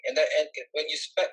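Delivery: ordinary talk, nothing marked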